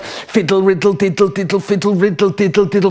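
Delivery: high, sing-song babble; repetitive fiddling patter. Acted. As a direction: chatter it high, like endless tinkering. Acting out endless fiddling with time.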